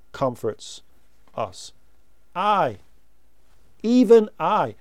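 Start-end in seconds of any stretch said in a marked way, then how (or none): 2.3-4.7 "I, even I" with a strong push on each "I"; emphatic I. Shown as a demonstration. Preacher stresses God's own words to the congregation, emphatic. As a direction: lean hard on "I" both times. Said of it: "he emphasizes it".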